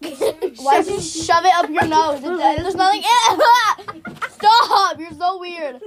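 loud, stretched-out giggly disbelief; laughing protest. Real, not acted. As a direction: scold a friend while cracking up. Shrieking at friend's silly stunt.

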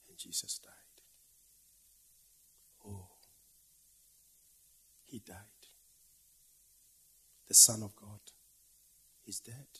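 hushed, grieving whisper; mournful whisper. Acted. Preacher mourning the dead Son of God in a whisper. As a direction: breathe the words like at a graveside.

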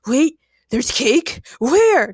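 slow, loud, high astonishment; eager demand. A narrator's reading. Chad hears about cake, demanding. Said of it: "Chad demanded".

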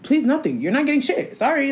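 high-pitched sassy refusal; dismissive retort. Retold or imitated. Retelling how she shut down his plea, dismissive.

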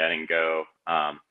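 normal talking voice

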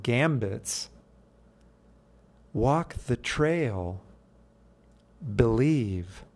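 slow, lifted recital of his poem; poem reading. Acted. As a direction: read it out like a spoken-word piece. Reads his own poem.

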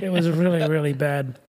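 usual speaking voice

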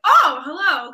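loud startled nervous hello. Retold or imitated. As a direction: blurt it out, flustered and loud. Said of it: "'cause I was really nervous".

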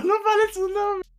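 high, squeaky laughing plea; crying with laughter. Real, not acted. Still laughing, begging them stop.

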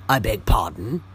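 cold, low, offended retort; icily. Acted. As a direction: freeze him out with the question. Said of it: "said Thornton icily".

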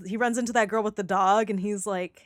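usual speaking voice

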